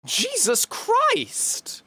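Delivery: drawn-out high Jesus Christ, falling; slow, stunned Jesus Christ; exasperated disbelief. Real, not acted. Streamer reacting to a chat message, high and drawn out.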